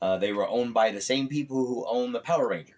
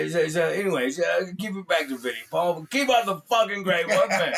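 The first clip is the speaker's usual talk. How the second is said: loud, raised British rocker impression; 7 semitones higher, 6 dB louder than usual. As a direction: sign off in a stammering rock-star holler. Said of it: "Act like [name]".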